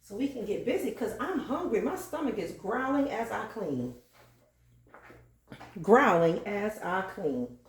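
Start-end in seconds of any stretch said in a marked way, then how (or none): 5.8-7.6 slow, deliberate restatement; preacher-style repeat. Acted. Repeating her own line like a preacher, slow emphasis. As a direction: stretch out GROWLING like a repeated scripture. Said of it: "I have to say it again".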